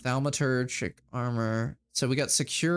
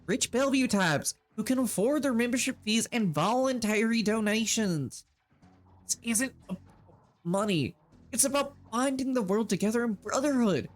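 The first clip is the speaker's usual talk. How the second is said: pitched-up, sincere sales pitch; earnest cult recruiter; 8 semitones higher than usual. Acted. Shane recruiting Terry for the Brotherhood, earnest voice. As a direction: sell her the Brotherhood.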